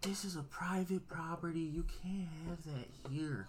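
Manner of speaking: mock official scolding, kept soft; lecturing security guard. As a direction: imitate a guard laying down the rules, slowing at the end. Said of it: "gives me the talk about".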